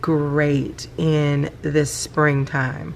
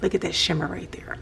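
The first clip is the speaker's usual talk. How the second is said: awed whisper; 6 dB quieter, much faster than usual. Real, not acted. Admiring the ink's shimmer, an awed whisper. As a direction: whisper it like you're in awe.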